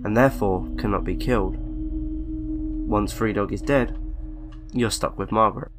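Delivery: ordinary delivery, nothing marked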